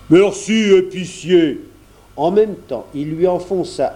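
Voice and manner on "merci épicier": put-on dropping the English accent, louder; mocking thanks in his own voice; natural voice. A narrator's reading. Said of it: "reprit sa voix naturelle". (voix naturelle, moqueuse)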